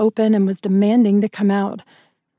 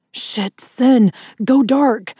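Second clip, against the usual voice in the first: raised, urgent voice to an ally; urgent shout; 3 semitones higher, 4 dB louder, much slower than usual. A narrator's reading. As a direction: shout a warning, higher and louder. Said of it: "Adam shouted".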